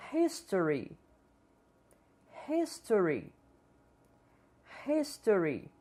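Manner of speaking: History starting with a light breathy h; English history. Shown as a demonstration. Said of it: "Vamos tentar repetir cada palavra".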